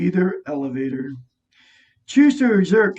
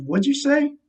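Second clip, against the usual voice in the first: high, puzzled "What'd you say?"; raised questioning voice; 8 semitones higher than usual. Retold or imitated. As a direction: call it out as if through noise. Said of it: "I had to say it to her".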